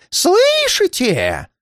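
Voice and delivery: very high, long drawn-out wail; stretched, high-pitched cry of dread; terrified moan. A narrator's reading. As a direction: wail the question in rising panic. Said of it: "простонал он".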